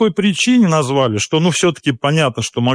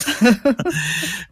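laugh; 8 semitones higher than usual. Real, not acted. Guest reacts to host's remark, amused high chuckle.

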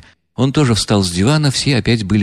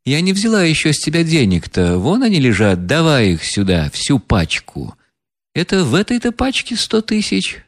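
higher, contemptuous woman's voice; scornful heroine; 4 semitones higher than usual. Acted. Настасья Филипповна презрительно смеётся над деньгами. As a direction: sneer at the money and the crowd. Reader voices Nastasya Filippovna.

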